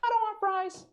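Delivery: high put-on girly voice; mock girlfriend voice. Girlfriend refusing fries in a high coy voice. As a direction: insist sweetly, high. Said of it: "double double with fries".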